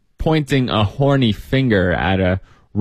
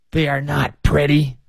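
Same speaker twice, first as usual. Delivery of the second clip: put-on old woman speaking distinctly; 4 semitones higher than usual. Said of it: "but very distinctly".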